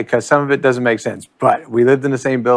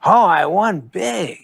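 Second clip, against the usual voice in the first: slow, higher mock Norm drawl; imitating Norm; 6 semitones higher, slower than usual. Sandler quoting Norm about his chip winnings, slow and higher. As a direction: lightly play the deadpan gambler. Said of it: "He goes".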